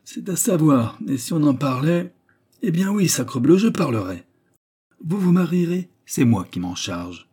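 voix de vieillard bourru qui s'échauffe, plus haute et plus forte; le vieux qui éclate et prend les choses en main; vieux bonhomme emporté. A narrator's reading. Noël s'emporte. (vieillard bourru qui s'emporte, plus fort)

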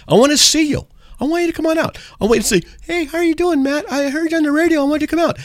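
squeaky high pitched listener impression; high mock caller voice. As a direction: pitch way up, play the eager fan. Inviting listeners, acting a caller.

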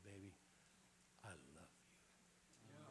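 tender whisper. Acted. Husband gazing into his wife's eyes, whispering tenderly. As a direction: breathe it like a lover's secret.

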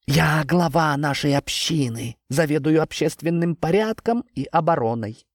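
a self-important tree chief; talking tree. Acted. Tree introduces itself. (говорящее дерево)

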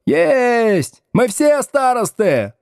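high-pitched jubilant classroom cry; high, drawn-out cheeky holler; gleeful yell. Acted. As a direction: crow it like rowdy pupils. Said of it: "ликующе завопила галёрка".

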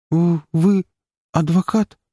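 high, halting, frightened; timid recognition. A narrator's reading. Scared girl recognizes the lawyer, timid and halting. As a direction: grab at a familiar face.

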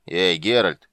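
loud shout after someone; calling out. A narrator's reading. Bogholt calls after departing Geralt, shouting. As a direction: call out forcefully to stop him. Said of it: "крикнул Богольд".

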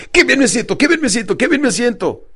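high-pitched enthusiastic chant; repeated affirmation. Retold or imitated. Coach drilling affirmations into his client, enthusiastic. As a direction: repeat it like an eager mantra. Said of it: "repitiendo continuamente".

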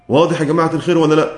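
usual speaking voice

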